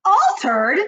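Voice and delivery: a father bellowing in disbelief; high loud disbelieving yell; shouted. A narrator's reading. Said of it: "shouted Mister Jenkins".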